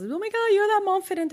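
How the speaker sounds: high squeal of a fan spotting a celebrity; excited fan. Retold or imitated. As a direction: gush like a starstruck shopper. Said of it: "at Bloomingdale's".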